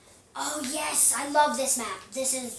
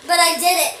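loud, higher proud cry; triumphant; 6 semitones higher, 16 dB louder, faster than usual. Real, not acted. Player proud of triple kill, loud triumph. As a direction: crow about it.

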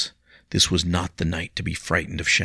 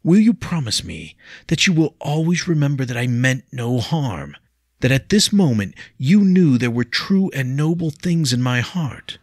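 higher, louder earnest plea; pleading; 8 semitones higher, 7 dB louder than usual. A narrator's reading. William begging Leah, earnest and raised. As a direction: beg to be remembered kindly.

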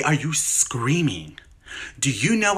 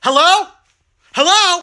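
loud hello; 9 dB louder than usual. Acted. Checking if caller hung up.

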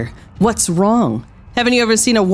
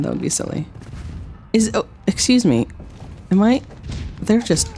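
normal talking voice

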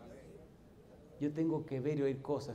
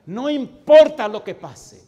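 much higher, much louder slow cry; fervent shout; 13 semitones higher, 23 dB louder, slower than usual. Acted. Pastor urging the church to trust God, slow full-voice shout. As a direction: cry it out with all your lungs.

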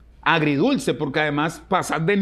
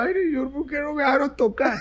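retold crying; 8 semitones higher than usual. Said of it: "me puse ahí a llorar".

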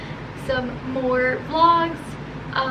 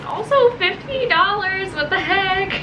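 delighted surprise; 6 semitones higher, 3 dB louder, slower than usual. Real, not acted. Grandparents gave unexpected money.

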